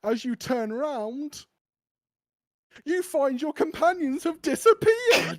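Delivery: high, loud, suspenseful scene setting; dramatic game master reveal. Acted. Game master revealing vanished companions to a player, dramatically.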